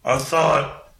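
rowdy orc. Acted. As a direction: bellow it like a friendly drunk orc. GM voicing rowdy orc bouncing.

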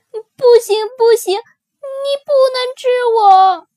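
put-on high, loud panicked child plea; screaming baby dinosaur. A narrator's reading. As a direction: shriek it out, terrified of being eaten. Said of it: "棘龙宝宝尖叫着". (高声惊恐地尖叫)

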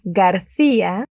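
García slow, with the stress on the í, gar-CÍ-a; slow teacher demo García, gar-CÍ-a. Shown as a demonstration. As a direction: lean on the í in García. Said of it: "Lleva tilde la I, por eso Ia".

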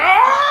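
very high imitated yelp of pain; mock pain scream. Imitating mom as her sore arm is pushed, high scream. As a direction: cry out like the hurt mom, played for laughs. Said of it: "She was like".

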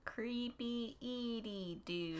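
slow, soft, drawn-out creepy delivery. Acted. Reading a note on creepy villains, slow and eerie. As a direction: stretch each word out quietly, eerily.

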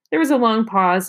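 usual speaking voice

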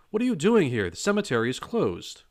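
high, loud, outraged demand; indignant shout. A narrator's reading. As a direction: confront them with indignation. Groundskeeper confronts intruders.

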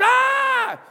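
a sudden high yell of frustration; frustrated yell. Retold or imitated. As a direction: let out a wordless shriek of anger. Emotions flare in a fight.

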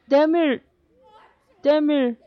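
loud, high calling out; screaming a name. A narrator's reading. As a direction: scream her name from afar. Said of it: "screamed the man".

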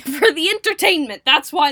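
loud, high, laughing delight; giddy and excited; gleeful. Real, not acted. Gleeful about cards.

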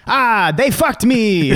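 put-on loud, slow, high Italian accent yell. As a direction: shout it like a furious Italian driver on the radio. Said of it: "in the most Italian accent, he screams".

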